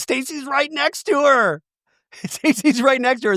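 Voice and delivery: high-pitched, amused disbelief; scandalized exclamation. Real, not acted. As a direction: exclaim it high, breaking into laughter. Aghast at the shady remark.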